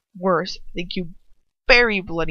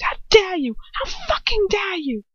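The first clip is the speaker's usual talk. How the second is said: much higher, furious disbelief; outraged; 11 semitones higher than usual. A narrator's reading. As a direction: spit out the outrage. Lucius raging at Ron.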